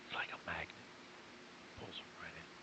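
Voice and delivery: soft whisper drifting toward half voice. Shown as a demonstration. Coach teaching a presentation trick, in a whisper. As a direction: whisper to pull listeners in. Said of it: "to hear me when I whisper".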